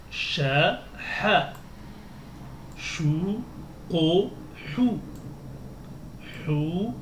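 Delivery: each Arabic syllable spoken slowly and carefully; syllable drill. Shown as a demonstration. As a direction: model the drill for learners. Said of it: "Entraînement trois".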